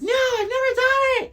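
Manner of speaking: quoting Claire, high and coy; mock high girly voice. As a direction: squeak it out like a shy girl. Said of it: "She's like".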